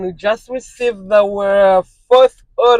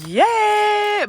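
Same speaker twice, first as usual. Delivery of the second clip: excited yeah; 12 semitones higher than usual. Real, not acted. Excited exclamation.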